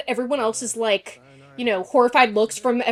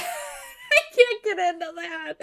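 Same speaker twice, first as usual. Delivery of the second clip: higher, slow, drawn-out whimper; mock whine; 8 semitones higher, slower than usual. Whining to viewers about the show, mock crying. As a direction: stretch it out like a fake sob.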